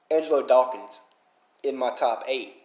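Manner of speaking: usual speaking voice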